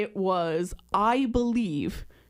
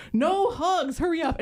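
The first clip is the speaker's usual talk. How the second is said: mock scream; 7 semitones higher than usual. Imitating a bodyguard hurrying fans along, mild mock scream. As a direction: imitate a hurried bodyguard's yell, held back. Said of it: "I can hear the bodyguard screaming".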